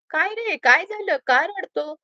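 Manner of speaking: lightly acted concern, pitch lifted; concerned questioning. A narrator's reading. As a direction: ask with a touch of alarm what is wrong. Colleague finds crying boy, asks why.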